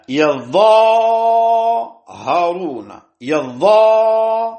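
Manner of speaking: "يظاهرون" as yaZZaaharun, the aa held long, high and loud; doubled Za, long alif. Shown as a demonstration. Teacher demonstrating the Kufans' reading, "يظاهرون" with doubled Za and long held alif. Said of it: "بفتح الياء وتشديد الظاء وألف بعدها".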